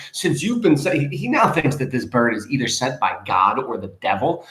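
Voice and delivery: ordinary delivery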